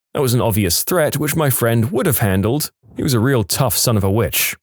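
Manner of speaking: ordinary delivery, nothing marked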